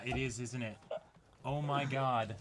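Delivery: normal talking voice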